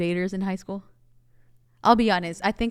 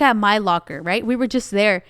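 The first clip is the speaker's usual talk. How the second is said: normal talking voice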